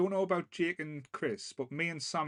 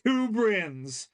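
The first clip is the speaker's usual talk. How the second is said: movie trailer voice; 6 semitones higher, 4 dB louder, much slower than usual. Acted. Announcing the movie title.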